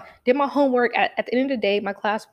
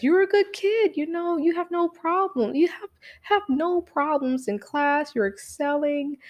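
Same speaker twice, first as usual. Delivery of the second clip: a hint of a patronizing school principal; mock soothing principal; 7 semitones higher than usual. Principal's soothing lecture voiced.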